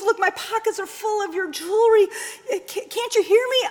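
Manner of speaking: high, loud, rushed tearful plea; desperate pleading. Acted. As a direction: beg her to listen.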